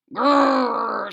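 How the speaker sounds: loud growl; rhino growl. Acted. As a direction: growl it like a big beast. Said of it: "said the rhinoceros".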